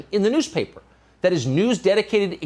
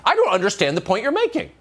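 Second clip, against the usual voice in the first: lightly mimicked politician; 6 semitones higher than usual. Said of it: "Of course she doesn't understand the point".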